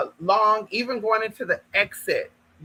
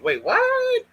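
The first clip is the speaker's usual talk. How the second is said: very high mock disbelief; shocked. Hearing about Denny's, high shocked squeak.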